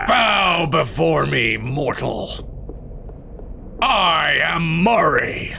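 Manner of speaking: slow, commanding evil overlord; a hint of a booming villain; dark lord. Game voices skeleton minion.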